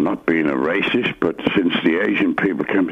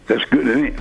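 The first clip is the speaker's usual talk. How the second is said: normal talking voice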